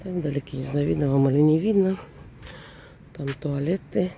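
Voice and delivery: ordinary delivery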